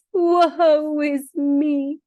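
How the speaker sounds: slow, hammed-up woe; dramatic crayon lament. Crayon lamenting lost colors, slow and theatrical. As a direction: wail it slowly like a tragic actor.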